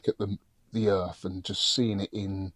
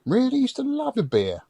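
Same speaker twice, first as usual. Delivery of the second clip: surprised. Real, not acted. Surprised friend stops drinking.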